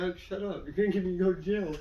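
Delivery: soft, urgent aside; retold hushed scolding. Passenger to friend in front of cop, hushed scolding. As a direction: shush a friend under your voice.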